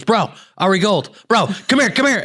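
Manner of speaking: mock-hype a fan spotting a celebrity; excited bro fan. Fan in burger joint calls him over, high excited bro. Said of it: "The guy goes".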